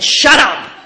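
loud, high comic outburst; mock angry yell. Speaker to her teasing AA sisters, mock angry yell. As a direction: fire it back at teasing friends.

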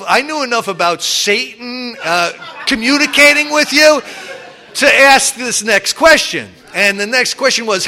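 usual speaking voice

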